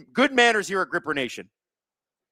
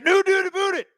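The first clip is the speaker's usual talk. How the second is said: very high mock-Swedish complaint; mock angry Swedish. Commentator mimicking a penalised player, mad in mock Swedish. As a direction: imitate an angry Swede's gibberish protest. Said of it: "He's mad in Swedish".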